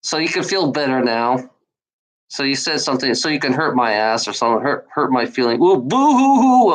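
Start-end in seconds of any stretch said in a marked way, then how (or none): 5.6-6.7 high, loud fake sobbing; sarcastic fake blubbering; mock boo-hoo. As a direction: ham up a crybaby wail. Mock crying at trolls.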